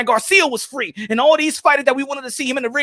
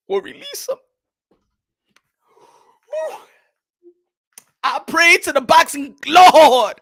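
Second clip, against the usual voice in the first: mock preaching; 3 semitones higher than usual. Preaching for the fighter's release, mock sermon prayer. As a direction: pray it like a mock revival preacher. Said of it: "We preaching here".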